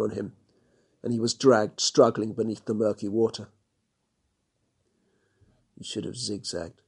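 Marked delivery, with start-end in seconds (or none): 5.8-6.8 soft, dry mutter. Acted. Johann after watching the crocs, soft mutter. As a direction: mutter it under your breath. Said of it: "Johann muttered".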